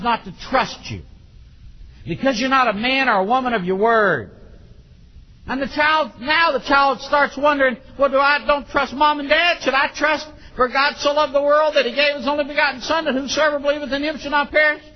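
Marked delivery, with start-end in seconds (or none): 5.5-14.8 high, driving sermon delivery; forceful preaching. Real, not acted. Preacher warning parents about children's trust, forceful. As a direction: press on urgently without letting up.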